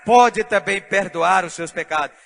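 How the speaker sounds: loud, chanted preaching build; preaching at full force. Real, not acted. Pastor rousing the congregation, loud chanted preaching. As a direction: preach it out, ringing and triumphant.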